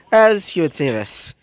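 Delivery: ordinary delivery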